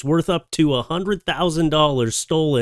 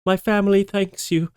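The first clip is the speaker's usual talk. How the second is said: grateful cat; 7 semitones higher than usual. Acted.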